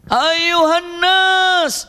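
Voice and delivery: very slow, high drawn-out call; shouting call. Retold or imitated. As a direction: summon the whole crowd, stretched long and high. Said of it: "Maka berteriaklah". (seruan panjang bernada tinggi)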